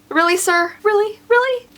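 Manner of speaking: rising exasperated repetition; mounting disbelief. Acted. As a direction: push each really up a notch, exasperated. Venting disbelief at pedestrian.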